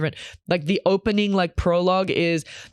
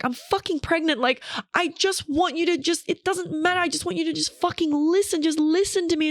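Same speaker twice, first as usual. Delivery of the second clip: much higher, pleading and exasperated; retold upset wife; 11 semitones higher than usual. Acting a pregnant wife to her fixing husband, upset pleading. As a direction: plead in a high, worked-up voice. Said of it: "now they're upset with each other".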